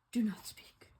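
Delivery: a soft, voiceless whisper. Acted. Gerald hushing Piggy before parting, whisper. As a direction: hush them with a whisper.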